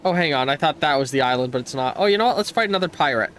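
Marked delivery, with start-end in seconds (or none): none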